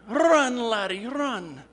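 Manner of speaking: high, loud Scottish cheer of "Run, laddie"; Scottish yell. Retold or imitated. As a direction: shout it like an excited Scottish fan. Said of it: "The Scotsman jumps up again".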